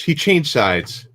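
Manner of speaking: slowly spelled out so it can't be misheard; slow, clear repeat. Real, not acted. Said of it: "To die?".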